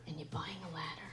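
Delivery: whispered disbelief; mock whisper. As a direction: whisper it like a secret insult.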